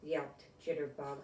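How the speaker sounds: bright, storybook read-aloud voice; reading to children. Acted. Grown-up reading a picture book to kids, storybook voice. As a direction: read it like bedtime story time.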